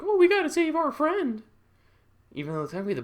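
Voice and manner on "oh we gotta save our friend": high, loud pleading character voice; female lead. Acted. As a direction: cry out in a put-on high voice. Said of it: "Female will be like".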